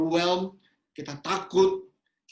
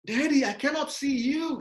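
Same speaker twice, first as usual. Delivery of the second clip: put-on child pleading; 8 semitones higher than usual. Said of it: "Lalu dia bilang".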